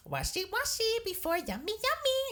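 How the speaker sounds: very high, chirpy rhyming chant; buffet greeter's singsong. Retold or imitated. Sanitizer woman to every family coming through, high singsong. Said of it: "see this woman do it".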